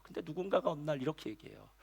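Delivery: ordinary talk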